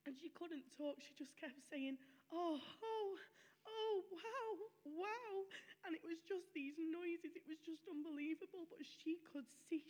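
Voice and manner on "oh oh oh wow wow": imitating awe, much higher and louder; gran's awe. Dying gran seeing something with eyes closed, imitated in loud awe. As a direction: gasp out the wonder.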